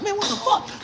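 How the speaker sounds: high retold yelp of shock; shocked disbelief. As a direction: squeal as the pepper heat hits. Retold shock at hot pepper.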